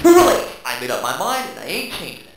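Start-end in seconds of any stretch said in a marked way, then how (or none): 0.0-0.5 high, loud gleeful retort; defiant shout. Acted. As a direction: snap back triumphantly.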